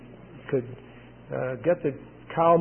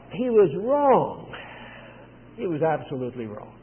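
ordinary talk, nothing marked

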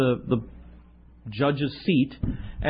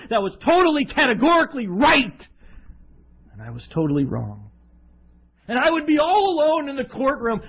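preaching; 8 semitones higher than usual. Acted. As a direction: preach it with growing force. Preaching the heavenly courtroom.

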